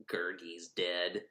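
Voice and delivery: low, very soft, slow mournful murmur; mock sorrow. Friends mourning Gurgi, soft fake gloom. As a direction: let it sink in with fake gloom.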